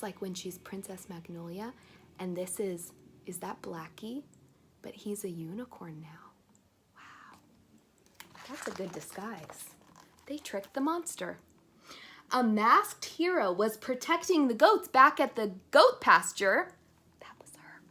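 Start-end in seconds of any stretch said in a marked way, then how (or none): 4.8-9.7 unvoiced whisper; hushed aside. Real, not acted. Secret disguise aside. 17.2-17.8 unvoiced secret whisper; hushed reveal. Real, not acted. Secret identity hint.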